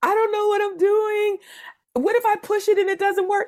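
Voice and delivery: very high, panicked wail; imitating a tearful, helpless phone call; mock crying. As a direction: wail it in a tiny high voice, hamming up the tears. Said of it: "I called Che crying".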